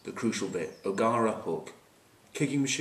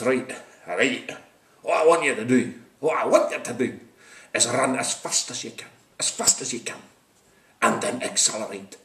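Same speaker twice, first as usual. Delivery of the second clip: emphatic Scottish rugby coach; Jim Telfer; 2 semitones higher, 4 dB louder than usual. Acted. Coach instructing the Lions, emphatic Scottish accent. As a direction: drive each instruction home in a Scottish accent. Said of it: "difference between the Scots and the English".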